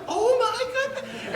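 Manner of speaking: high, slow laughing Oh my goodness; inner thought. Retold or imitated. Newlywed husband's stunned inner thought, laughing. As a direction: laugh through it in disbelief. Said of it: "I kept thinking".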